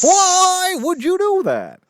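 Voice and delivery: high, loud, drawn-out comic wail; hammed-up sitcom horror; horrified wail. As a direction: wail it slowly in mock anguish. Horrified reply in acted sitcom.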